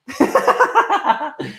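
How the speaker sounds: high-pitched delighted cackle; gleeful fan laugh; high giggle. Real, not acted. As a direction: burst into a squeaky laugh. Laughs at own joke.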